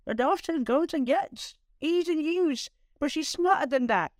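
high, lightly put-on Irish teacher voice; a rough try at an Irish teacher's lilt; a half-hearted Irish accent for the science teacher; attempted Irish accent. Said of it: "I wish I could do an Irish accent".

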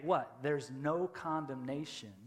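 ordinary delivery

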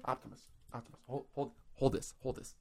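hushed unvoiced aside; whispering. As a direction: whisper it off to the side. Acted aside to Optimus.

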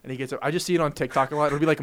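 ordinary talk, nothing marked

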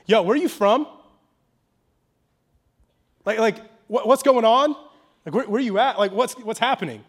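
lightly acted incredulous demand; bewildered Pilate. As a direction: stammer out baffled questions, pressing for an answer. Voices Pilate questioning Jesus, modernised.

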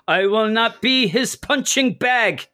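high, slow action-hero yell; Jean-Claude Van Damme. Retold or imitated. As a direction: ham up the martial arts movie line. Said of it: "is what he yells at one point".

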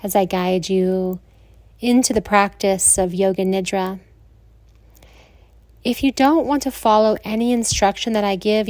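soothing Yoga Nidra guidance with long pauses; guided meditation. Acted.

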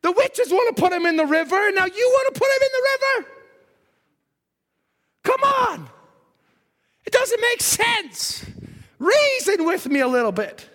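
high, frantic pleading near tears; mother begging not to lose her baby. Acted. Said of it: "she starts to cry and say".

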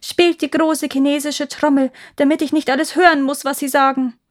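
high, loud, urgent command; loud demand. A narrator's reading. Emperor demands music to drown the faces, loudly. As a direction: call out desperately to drown the voices. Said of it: "Dann verlangte er laut".

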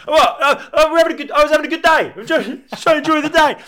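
put-on high, loud, aggrieved drunken whine; drunk mate protesting. Drunk mate being pulled away at a wedding, loudly whining. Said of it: "Just like pulling his".

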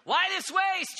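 loud, high outraged protest; shrill disciple griping about wasted money; indignant. Acted. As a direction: complain with mock outrage at the waste. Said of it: "They're indignant".

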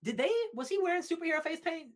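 high and fast, bursting with curiosity; high, fast, eager question; excited rush. Real, not acted.

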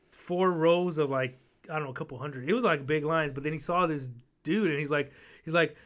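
normal talking voice

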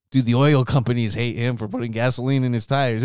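usual speaking voice

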